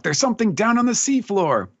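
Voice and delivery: high-pitched acted alarm; shocked. Two samurai looking down from the boat, shocked cry. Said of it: "looking down in, in shock".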